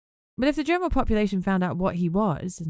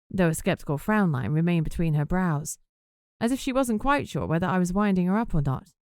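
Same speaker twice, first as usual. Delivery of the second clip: plain reading voice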